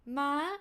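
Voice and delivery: "ma" from mid, lifting to high. Shown as a demonstration. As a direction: push "ma" upward at the end. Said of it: "increase to high".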